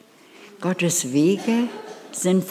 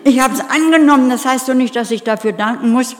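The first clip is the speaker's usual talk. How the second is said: louder, higher and fast, bristling; indignant retort; 4 semitones higher, 6 dB louder, faster than usual. Retold or imitated. Single woman snapping back at the preacher, indignant. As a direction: snap back like an offended single. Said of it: "kratzen die mir fast die Augen aus".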